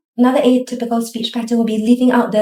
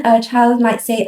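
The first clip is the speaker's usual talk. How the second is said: ordinary delivery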